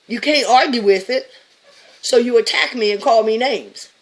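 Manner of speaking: loud, high, heated; loud high-pitched rant; ranting shout. Real, not acted. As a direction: yell it at the doubters. Ranting at critics.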